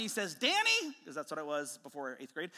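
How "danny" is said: brother yelling his name, high and loud; high, sharp call to a younger sibling; retold yell. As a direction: yell it like a brother calling out a warning. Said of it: "he yells at me".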